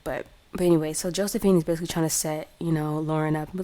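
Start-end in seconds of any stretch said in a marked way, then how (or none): none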